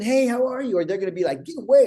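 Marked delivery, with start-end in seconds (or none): 0.0-0.8 high, welcoming mock hello; inviting greeting. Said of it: "like, inviting people in". 1.4-1.9 high, loud mock recoil; scared. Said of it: "Like, they're scared".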